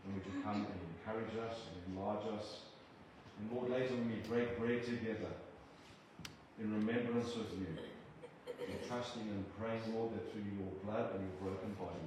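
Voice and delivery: measured, reverent prayer to God; earnest prayer. Acted. Preacher praying over the church family, earnest prayer. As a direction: pray it humbly over the congregation.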